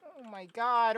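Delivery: normal talking voice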